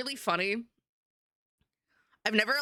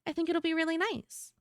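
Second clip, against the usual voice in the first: lighter, higher airy character voice; 9 semitones higher than usual. Shown as a demonstration. Streamer demos an everyday character voice to viewers, light and airy. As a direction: keep it sweet, airy and demure. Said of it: "lighter, airy voice like this".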